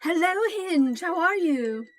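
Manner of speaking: lightly voiced adventurer, friendly hello; a touch of a put-on hero voice; character voice greeting. Edwina greeting the shopkeeper, lightly voiced.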